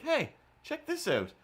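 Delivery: put-on high perky girl voice; lightly voiced young woman. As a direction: do her chirpy hello. Imitating the waitress.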